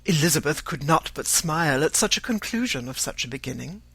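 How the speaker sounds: ordinary delivery, nothing marked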